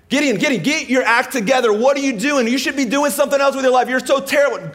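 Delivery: high, loud mock nagging; harsh scolding. Mock God telling Gideon to shape up, scolding. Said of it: "God never speaks like that".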